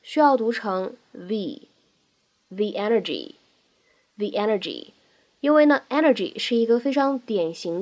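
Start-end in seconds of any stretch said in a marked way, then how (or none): 1.1-4.9 "the" said as "thee" before "energy"; strong form. Shown as a demonstration. Teacher drilling the strong form, "the" as "thee". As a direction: stress "the" with a long ee vowel. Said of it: "读成一个重读的形式".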